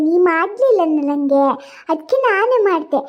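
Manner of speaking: ordinary talk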